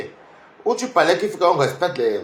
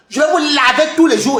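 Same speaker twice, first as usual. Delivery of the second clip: angry threat; 8 semitones higher, 4 dB louder than usual. Real, not acted. Threatening rivals who mention him.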